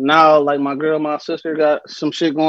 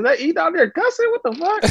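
grandma voice; 10 semitones higher, 3 dB quieter than usual. Retold or imitated. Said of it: "she used to be like".

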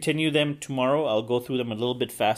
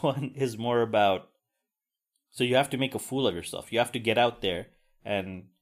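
ordinary talk

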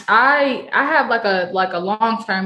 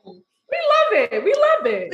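much higher, very slow and drawn out; gleeful, stretched-out cheer; delighted; 13 semitones higher, much slower than usual. Real, not acted. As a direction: savour it with delight. Celebrates the birthday match.

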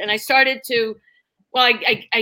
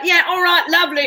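higher put-on British waitress voice; British accent; 6 semitones higher than usual. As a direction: chirp it like a cheerful English waitress. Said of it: "So I'd be like".

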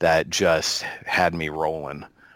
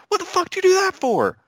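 sky-high, louder squawk of outrage; imitating a furious movie gangster, far higher; Samuel L. Jackson impression; 4 dB louder than usual. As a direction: shriek the line in mock disbelief. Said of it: "Samuel L. Jackson responds with".

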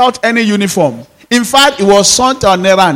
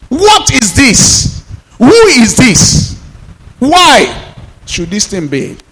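defiant challenge; 5 semitones higher, slower than usual. Acted. Preacher acts out defiant challenge.

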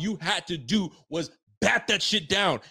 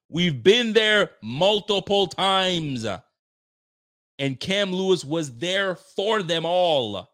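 frustrated emphasis; 4 semitones higher, 5 dB louder, much slower than usual. Real, not acted. Frustrated rant about repeated mistakes.

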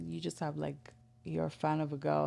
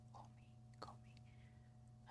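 breathy whisper, very soft; 28 dB quieter, much slower than usual. Retold or imitated. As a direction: whisper it like a come-on. Phone sex ad call-out.

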